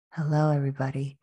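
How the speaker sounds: a hint of a low-octave voice; imitating friend's low voice. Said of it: "has a very low octave in her voice".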